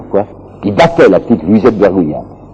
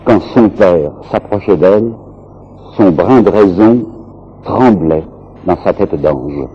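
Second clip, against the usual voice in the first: slow, measured quotation with pauses; slowly; 2 semitones lower than usual. Shown as a demonstration. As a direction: read it out slowly, phrase by phrase. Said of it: "je vais vous le dire lentement".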